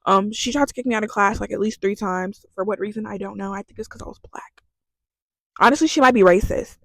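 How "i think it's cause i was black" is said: half-whispered, much softer; hushed aside. Real, not acted. Confiding why the professor kicked her out, hushed aside. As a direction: drop to a near whisper.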